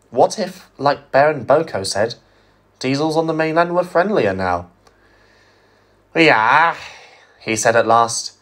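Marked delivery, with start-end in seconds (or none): 6.2-6.8 even Scottish engine voice. Acted. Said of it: "He spoke evenly".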